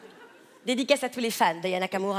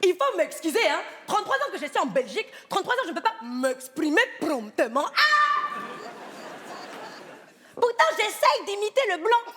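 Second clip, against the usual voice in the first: high, laughing hammed-up African accent; caricature African accent; 8 semitones higher than usual. Performing for the casting director in a hammed-up African accent. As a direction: play the African cliché to the hilt. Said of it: "je veux un accent africain".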